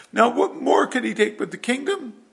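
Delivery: loud, high mock protest; whiny complaint. Saul fears David will take the kingdom, whiny complaint. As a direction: cry out as the aggrieved king. Acting out Saul's jealous complaint.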